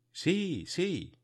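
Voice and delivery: high, eager yes; eager answer. A narrator's reading. Lost nephew answering his uncle, high and eager. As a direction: blurt it out in relief. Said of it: "respondí".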